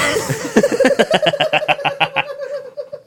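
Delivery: long, high helpless laughter; real laughing fit. Real, not acted. Co-host ruining his own intro take, helpless laughing. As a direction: crack up completely.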